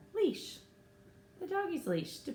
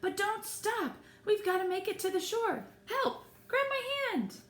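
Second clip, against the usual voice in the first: urgent; 13 semitones higher than usual. Acted. Urgent plea while escaping crabs.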